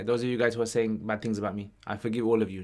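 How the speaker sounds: usual speaking voice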